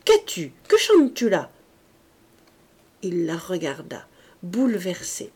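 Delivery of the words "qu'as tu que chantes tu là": exasperated shout. A narrator's reading. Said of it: "cria Berthe".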